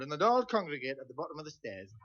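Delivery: ordinary delivery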